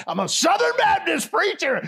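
high, loud mock preacher shout, laughing; hammed-up proclamation. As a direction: proclaim it with comic bombast. Exaggerated version of earlier reveal.

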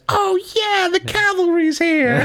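very high, bright and cheeky; Tracer voice. Retold or imitated. Tracer announcing backup has arrived, very high and cheeky. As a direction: chirp it up like a plucky pilot. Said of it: "And then Tracer says".

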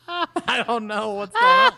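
laughing high and loud. Real, not acted. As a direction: burst out laughing. Laughing outburst at robot jab.

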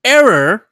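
"error" punched high and loud; emphasis on error. Real, not acted. Player fed up with trial and errors, emphasis on "error". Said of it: "I emphasize the word".

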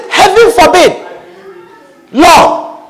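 high, emphatic protest; Peter's reprimand. Retold or imitated. Peter rebuking his Lord, high-pitched protest. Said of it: "began to reprimand him".

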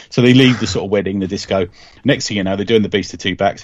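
normal talking voice

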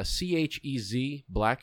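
spelled letter by letter, slowly. Shown as a demonstration. Spelling the restaurant name for the hosts, letter by letter. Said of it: "S C, uh".

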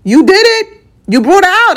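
high, loud, gleeful announcement; mock triumphant. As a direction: crow it like you caught them out. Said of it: "I'd be like".

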